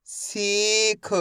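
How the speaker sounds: सीख very slow, with a long ee; stretched pronunciation. Shown as a demonstration. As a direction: hold सी, then release a short ख in सीख. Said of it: "मैं इसका खींच के उच्चारण कर रहा हूँ".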